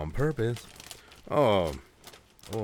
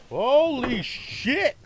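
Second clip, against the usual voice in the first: slow, high startled exclamation; 12 semitones higher, much slower than usual. Real, not acted. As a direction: jump at a sudden sound. Startled by sudden noise.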